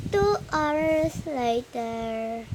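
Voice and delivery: slow, drawn-out announcer line; time-skip narration. Pretend. Narrating the story's time jump, very slow. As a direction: stretch it like a title card.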